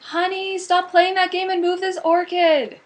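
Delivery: high, loud call to another room; yelling across the house. Retold or imitated. Wife yelling at husband to stop gaming, loud call. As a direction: holler it so he hears from far off. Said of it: "we were always yelling".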